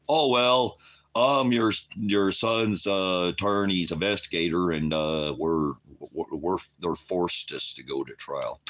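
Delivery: a mild mock of a fumbling investigator; mocking the official. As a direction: play the stammering official making excuses. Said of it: "quoted official excuse".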